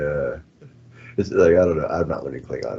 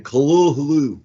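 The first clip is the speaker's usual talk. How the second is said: loud mock Kalulu; 6 semitones higher, 6 dB louder, slower than usual. Shown as a demonstration. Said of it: "That's it".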